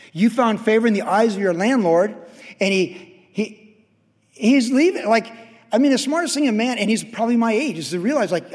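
high, loud pulpit preaching that keeps climbing; fervent preaching. Acted. Pastor exhorting his congregation to give, in full fervent preaching. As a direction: pound the message home from the pulpit.

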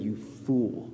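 low, hushed, grave reproof; quiet rebuke. Acted. Jesus answering the rich fool, quietly grave. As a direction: drop low and soft to reprove. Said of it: "And then Jesus said".

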